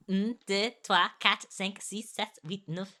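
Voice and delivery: counting "Un, deux" in heavily anglicised French; counting with a bad French accent; terrible French accent. Shown as a demonstration. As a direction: count to nine in clumsy school French. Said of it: "that's terrible accents".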